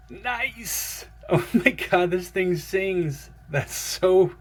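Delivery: high, loud delight; thrilled. Real, not acted. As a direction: gush over the sound. Hearing the blade ring.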